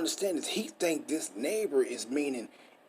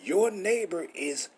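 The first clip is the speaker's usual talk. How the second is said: ordinary delivery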